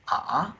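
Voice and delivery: smoothly rising "Ah"; second tone. Shown as a demonstration. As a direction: lift "Ah" upward like a question. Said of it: "the second tone from here to here".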